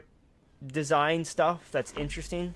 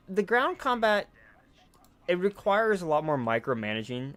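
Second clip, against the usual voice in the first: normal talking voice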